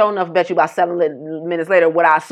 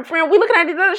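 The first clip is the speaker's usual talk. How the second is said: usual speaking voice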